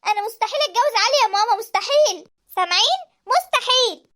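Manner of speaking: رفض بصراخ. Acted. البنت ترفض الجواز صارخة.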